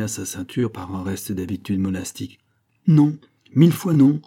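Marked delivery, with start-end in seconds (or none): 2.9-4.2 high, loud, emphatic outcry; exclaimed refusal. A narrator's reading. Priest refuses to hand over the keys, crying out. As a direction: cry out an absolute refusal. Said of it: "s'écria-t-il".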